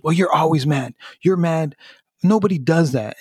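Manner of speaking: accusing. Retold or imitated. Retells daughter's accusation.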